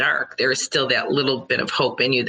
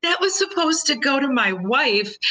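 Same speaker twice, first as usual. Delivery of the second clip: high-pitched, mock-mortified pleading; hammed-up embarrassed panic; horrified apology; 11 semitones higher than usual. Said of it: "it's this horrified emoji".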